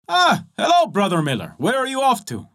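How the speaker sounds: the tailor and smith greet the dusty miller. Acted. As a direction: call out a friendly hello.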